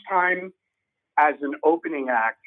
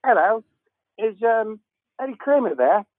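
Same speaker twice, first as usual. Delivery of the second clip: put-on a light British accent, higher, with a lifting question; hint of British in a raised, polite ask; 7 semitones higher than usual. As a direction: impersonate a British caller asking politely for Eddie Kramer.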